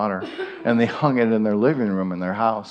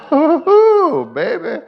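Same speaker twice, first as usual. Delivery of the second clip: high, loud joyful whoop; excited whoop; 7 dB louder, slower than usual. Real, not acted. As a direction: whoop with delight at the car. Showing off the restored car.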